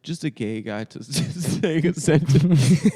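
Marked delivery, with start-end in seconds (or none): 1.0-3.0 loud, slow, broken up by laughter; laughing through words. Real, not acted. Comic explaining why it's funny, real laughing speech. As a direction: barely get it out for laughing.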